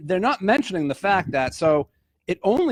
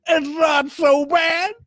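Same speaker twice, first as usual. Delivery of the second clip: high, slow sing-song voice; Bill Cosby impression; 9 semitones higher, slower than usual. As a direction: stretch it out like Cosby. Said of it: "I could do a great Bill Cosby".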